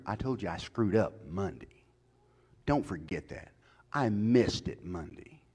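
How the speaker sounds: ordinary talk, nothing marked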